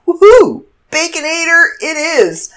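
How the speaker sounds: cheering. Retold or imitated. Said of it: "I'm like".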